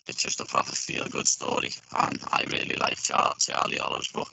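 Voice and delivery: glitchy robotic voice over a bad line; broken-up robotic call audio. Real, not acted. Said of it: "you've sounded robotic for some reason".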